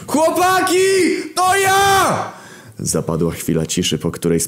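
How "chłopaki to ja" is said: very high, far-carrying yell; full-force scream. Acted. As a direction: call out to the lads with all your might. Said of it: "wrzasnąłem z całych sił".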